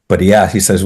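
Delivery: usual speaking voice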